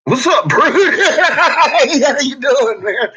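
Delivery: loud, high, laughing through a drawn-out greeting. Real, not acted. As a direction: greet him while cracking up, stretching the words slowly. Greeting someone while laughing.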